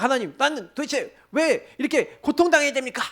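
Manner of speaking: imitating his own grumbling prayer; complaining. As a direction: protest your suffering to God. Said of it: "막 그러면서".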